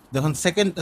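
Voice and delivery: ordinary talk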